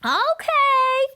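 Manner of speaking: high, loud class shout; high loud chorus of kids; kids shouting back. Acted. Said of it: "we shouted back".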